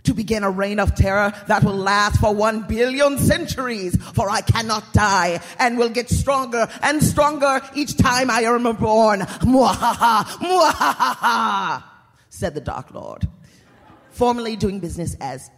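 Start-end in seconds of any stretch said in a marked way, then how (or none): 0.0-9.2 loud, booming dark lord speech; booming echoing villain monologue. Acted. Villain monologue with evil laugh. 9.4-11.8 slow, loud villain cackle; evil laugh. Acted.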